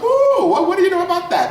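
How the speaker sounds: very high, laughing mock gasp; mock delighted surprise. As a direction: act amazed they said the title. Mock surprise at title drop.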